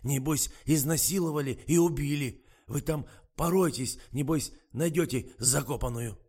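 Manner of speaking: loud, agitated guessing. A narrator's reading. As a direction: blurt it out alarmed. Driver alarmed speculating.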